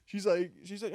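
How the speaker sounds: ordinary talk, nothing marked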